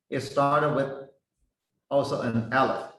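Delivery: ordinary delivery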